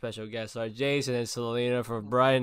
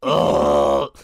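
mock gag groan; 2 semitones higher, 11 dB louder than usual. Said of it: "and she's like".

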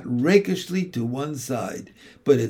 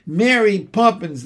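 higher, louder call after her; 6 semitones higher, 5 dB louder than usual. Acted. The children calling after Mary Poppins, loud and high. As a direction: call to someone flying away. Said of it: "they cried".

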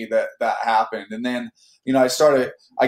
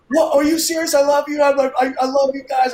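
very high, loud overjoyed outburst; freaking out; 4 dB louder than usual. Retold or imitated. Actor just told he got the part, freaking out. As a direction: re-enact losing it with sudden joy. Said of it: "I start freaking out and going crazy".

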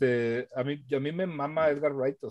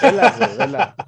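excited urging; 8 semitones higher, 10 dB louder than usual. Real, not acted. Urging friend to watch it.

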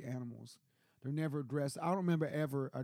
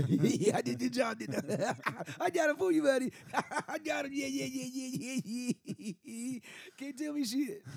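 high, goofy voice of the dog bragging; 8 semitones higher than usual. Retold or imitated. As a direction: play the proud dog, high and giddy with laughs. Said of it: "And he's like".

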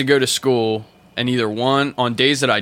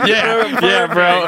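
ordinary talk

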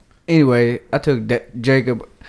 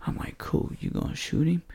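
soft whispered exchange; 7 dB quieter than usual. Retold or imitated. Two hunters whispering in the blind. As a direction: keep it breathy and hushed. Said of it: "We had to whisper".

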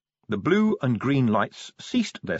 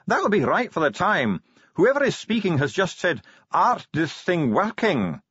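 high, deliberate dwarf voice; Bashfulson, dwarf translator; 7 semitones higher than usual. A narrator's reading. Dwarf translates the box's first words, high and measured. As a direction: translate it high, slowing for the quote.

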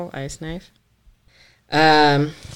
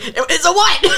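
high, loud, laughing disbelief; mock-shocked, pitched way up; incredulous outburst; 6 dB louder than usual. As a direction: blurt it out in disbelief. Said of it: "I was like".

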